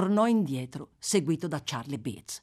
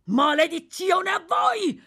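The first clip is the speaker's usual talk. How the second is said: high, drawn-out shouted curse; desperate yell; 12 semitones higher, 6 dB louder, slower than usual. A narrator's reading. Cornered criminal threatens the crowd below, desperate yell. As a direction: yell a curse at the whole mob. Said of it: "urlò il delinquente ridotto alla disperazione".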